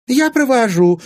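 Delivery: very high, loud, sudden offer; eager offer. A narrator's reading. Anfisa jumping up to escort Timofey, eager. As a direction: pipe up at once, high and loud.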